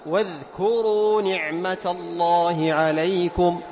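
slow recitation of واذكروا نعمة الله عليكم, ذ voiced and kept clear of the ك; tajweed demo of واذكروا. Shown as a demonstration. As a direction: recite واذكروا slowly, dhal sounded out. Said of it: "لاحظ".

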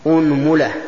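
"أنملة" said as unmula; opening u, middle u. Shown as a demonstration. Teacher lists variants for students, "أنملة" as unmula. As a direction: say "أنملة" with damma on hamza and mim. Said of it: "ضم الهمزة".

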